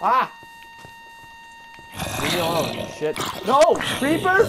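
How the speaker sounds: startled scream. Real, not acted. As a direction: scream at the monster in alarm. Screaming at a creeper attack.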